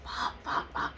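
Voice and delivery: unvoiced-sounding, breath-only repeated curse; whispered swearing. Injured woman feeling her leg, whispered swearing. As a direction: whisper the curse over and over under your breath. Retold muttering through the pain.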